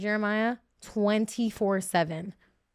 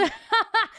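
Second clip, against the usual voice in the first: high bright burst of laughter, louder than her talk; 11 semitones higher, 3 dB louder than usual. Real, not acted. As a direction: laugh out at the joke. Laughing at ex-boyfriend joke.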